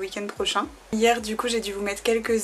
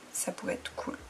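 whisper; 13 dB quieter than usual. Real, not acted. Quiet aside to viewers.

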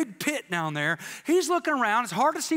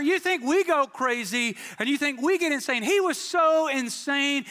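usual speaking voice

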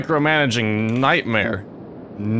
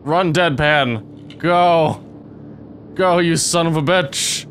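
frantic yelling; 7 semitones higher, slower than usual. Real, not acted. Gamer yelling at his fleeing character, frantic shout. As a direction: yell him out of danger.

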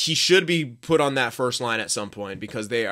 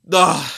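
loud, higher exasperated "ugh"; emotional groan; 5 semitones higher, 8 dB louder than usual. Real, not acted. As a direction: groan it out, overcome with feeling. Overcome wishing Marleau a cup.

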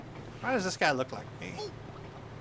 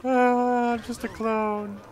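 mock crying; 2 semitones higher, 5 dB louder, much slower than usual. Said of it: "why you gonna cry".

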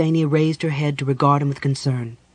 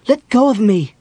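high, loud cry to be released; alarmed protest; 8 semitones higher, 9 dB louder than usual. A narrator's reading. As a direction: cry out to break free. Grabbed woman protests.

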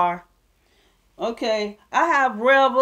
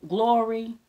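usual speaking voice

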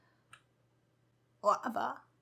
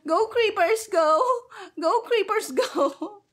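higher and louder chant with laughter; gleeful cheering; 7 semitones higher, 5 dB louder than usual. Real, not acted. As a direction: cheer them on, half laughing. Cheering on creepers in game.

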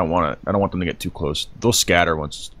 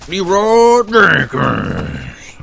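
long high drawn-out war cry; Leroy Jenkins battle cry; 9 semitones higher, 8 dB louder, much slower than usual. Retold or imitated. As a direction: charge in yelling it.

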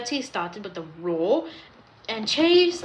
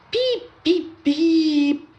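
high, short beeps; toy-train peeps, held on the last; imitated whistle beeps; 6 semitones higher, 4 dB louder, much slower than usual. A narrator's reading. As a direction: mimic an engine's whistle. Engine's whistle voiced in story.

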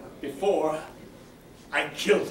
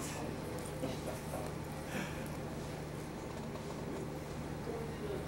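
long breathy chuckle; 14 dB quieter than usual. Acted. Madman chuckles in the dark.

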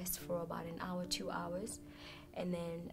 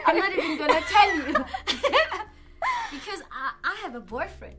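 much louder, higher, through giggles; laughing; 9 semitones higher, 16 dB louder than usual. Retold or imitated. Retelling the friend's boast, laughing through it. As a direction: speak while cracking up.

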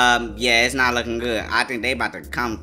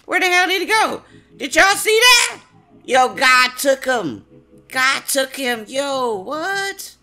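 shocked, much higher and louder; astonished disbelief; 11 semitones higher, 4 dB louder than usual. Real, not acted. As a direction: react as if the enemy vanished. Enemy vanished, astonished.

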